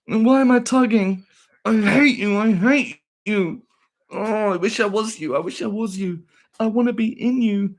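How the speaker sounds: high, loud, hammed-up anguish; mock tormented hater. Streamer mimicking an obsessed hater, overwrought high wailing.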